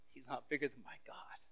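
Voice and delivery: a firm whisper; whispered conviction. Acted. David answering his brothers about Goliath, in a whisper. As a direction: breathe it out with certainty.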